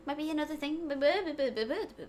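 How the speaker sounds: usual speaking voice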